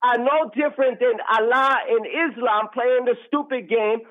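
ordinary talk

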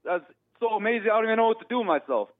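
high, fast gushing excitement; excited. Real, not acted. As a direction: bubble over with excitement.

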